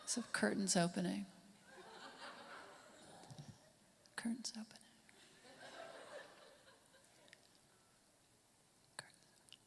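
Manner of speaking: very soft, fading to a whisper; hushed announcement. Acted. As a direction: whisper the title with mock gravity. Hushed setup for next impression.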